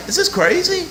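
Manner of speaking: retold disbelief. Retold incredulous reaction.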